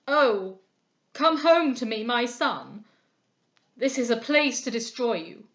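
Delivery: put-on a loud, broken plea from an old father; pleading father. A narrator's reading. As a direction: beg your son to come home. Father embraces son, pleading.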